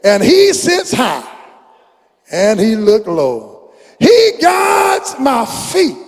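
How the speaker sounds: rising, soaring preacher chant of the lyrics; preaching a song. Acted. Preacher reciting a song to the congregation, soaring chant. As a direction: build it up, let it swell.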